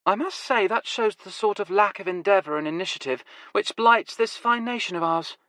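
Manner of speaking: grandly scolding lazy rats; Nevada's voice. Acted. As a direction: arch mock-patriotic.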